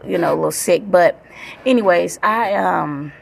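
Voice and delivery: ordinary talk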